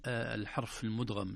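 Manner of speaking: normal talking voice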